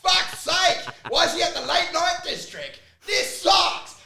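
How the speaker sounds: high, loud, laughing whiny outburst; mock exasperated rant. Sponsor griping about his sponsor kid, laughing loud rant. Mocking a guilt-tripped sponsor.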